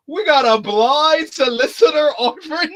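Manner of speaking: high, loud gleeful disbelief; gleeful villain. Retold or imitated. Bank robber mocking his hostage, loud gleeful disbelief. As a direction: crow it out with delight. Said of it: "He's like".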